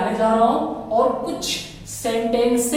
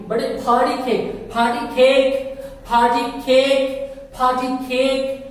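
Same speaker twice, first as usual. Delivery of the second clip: very slow, each sound stretched; slow classroom drill; much slower than usual. Shown as a demonstration. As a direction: say "party cake" slowly, sound by sound. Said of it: "teacher drills slowly".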